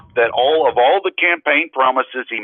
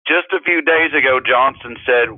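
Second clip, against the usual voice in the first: ordinary delivery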